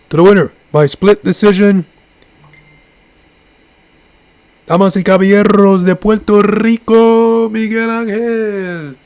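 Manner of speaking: high, drawn-out ring announcer call; Michael Buffer. Retold or imitated. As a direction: stretch the name out like a big fight intro. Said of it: "when Michael Buffer announced his name".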